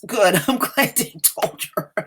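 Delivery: high-pitched real laugh through the words; gleeful laughing. Real, not acted. Reacting to the passerby telling her off, laughing gleefully. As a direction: crack up, pitch jumping up.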